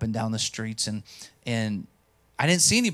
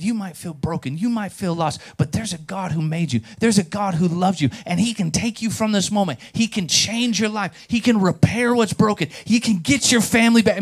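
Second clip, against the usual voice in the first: impassioned gospel appeal, climbing higher and louder; fervent preaching; 5 semitones higher, 3 dB louder than usual. Retold or imitated. Preacher telling a broken man of God, fervent and building. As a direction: build from earnest assurance to full preaching. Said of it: "I started preaching that gospel".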